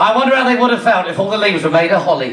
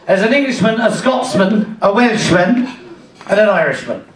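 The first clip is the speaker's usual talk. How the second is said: usual speaking voice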